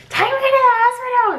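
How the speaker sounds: high, loud demand to be taken; high shouted plea; mock angry demand. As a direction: shout it as a desperate order. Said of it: "really angry and really anxious".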